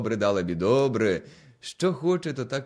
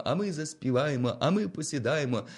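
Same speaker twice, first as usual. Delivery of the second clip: usual speaking voice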